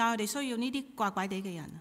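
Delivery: normal talking voice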